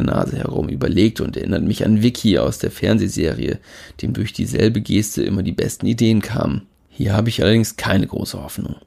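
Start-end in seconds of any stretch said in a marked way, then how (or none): none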